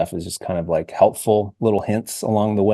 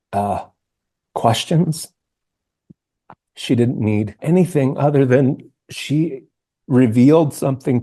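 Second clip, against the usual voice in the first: emotional; 3 semitones higher than usual. Real, not acted. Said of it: "I'm getting emotional talking about that now".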